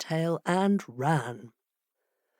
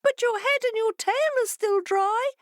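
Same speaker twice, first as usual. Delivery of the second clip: much higher, sly fish voice; fish calling back. A narrator's reading. Fish answering the complaining fox, high and sly. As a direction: chirp it back innocently. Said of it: "they called back to him".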